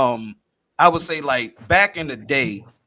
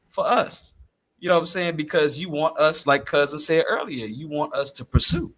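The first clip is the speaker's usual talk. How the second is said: normal talking voice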